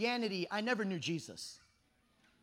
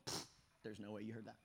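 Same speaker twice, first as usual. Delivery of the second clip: scoffing; 14 semitones lower, 16 dB quieter, faster than usual. Retold or imitated. Scoffing at the prophetic guys.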